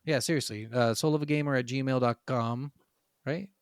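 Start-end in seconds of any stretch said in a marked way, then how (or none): none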